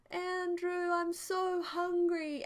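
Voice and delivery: high, slow, drawn-out hungry whine; whiny guilt trip. Retold or imitated. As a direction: guilt him into feeding you, dragging the words. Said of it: "guilt him".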